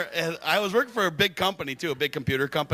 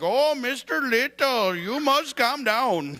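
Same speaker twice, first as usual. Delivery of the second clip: slow, higher mock Indian accent scolding; 6 semitones higher, slower than usual. Indian coworker tells hyper comedian to calm down, slow higher mock Indian accent. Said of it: "They're always yelling at me like".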